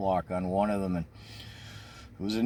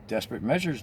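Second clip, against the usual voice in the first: normal talking voice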